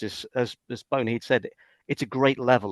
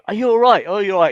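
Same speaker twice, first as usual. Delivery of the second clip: higher, louder "are you all right" calls; acted concerned check; 9 semitones higher, 9 dB louder, faster than usual. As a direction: call out loudly to check on someone hurt. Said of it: "I was there going".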